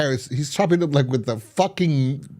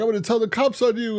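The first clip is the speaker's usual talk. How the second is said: high-pitched retold threat; mock threat; 11 semitones higher, faster than usual. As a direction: threaten indignantly, pitched well up. Acts out the injured man threatening.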